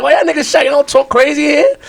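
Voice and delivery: acting clueless about why the kids gasp; mock innocent surprise. Boy acting innocent in front of shocked classmates. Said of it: "I'm like".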